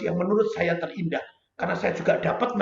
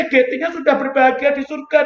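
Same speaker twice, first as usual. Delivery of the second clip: ordinary talk, nothing marked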